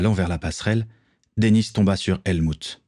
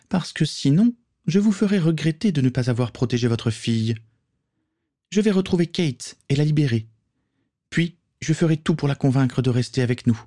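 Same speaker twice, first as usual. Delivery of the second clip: put-on higher, scornful young woman's voice; young pirate woman; 7 semitones higher than usual. Morgan scolds the count, scornful female voice. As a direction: talk down to the cowardly count.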